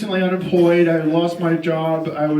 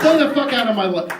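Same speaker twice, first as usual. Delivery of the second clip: louder take; 5 semitones higher, 3 dB louder than usual. Acted. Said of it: "Hold on a second".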